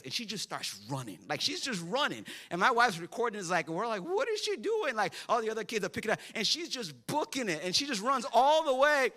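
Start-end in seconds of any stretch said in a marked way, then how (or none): 4.0-5.0 a bright, much higher cry of disbelief; voicing the parents' amused surprise as she bolts; surprised. Parents watching their daughter race off at the egg hunt, amazed high voice.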